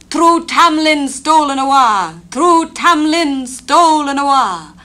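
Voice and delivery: high, loud wailing cry; unearthly cry. Acted. Fairies crying as Tamlin is taken, loud wail. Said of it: "there rose an unearthly cry".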